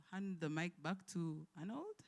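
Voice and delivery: normal talking voice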